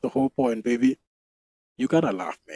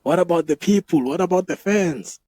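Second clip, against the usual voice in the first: higher, louder pleading objection, acted out; mock protest; 6 semitones higher, 5 dB louder than usual. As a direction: plead the fans' case, playing it up. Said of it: "I'm like".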